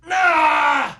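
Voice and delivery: loud, high wordless wail; Chewbacca. Retold or imitated. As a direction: imitate a wife's failed Wookiee cry. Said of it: "Holly tries to do the Chewbacca impersonation".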